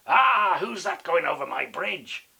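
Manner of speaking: mock-fierce troll bellow; roaring troll. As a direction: leap out and challenge the trespasser. Ugly troll leaps out at goat.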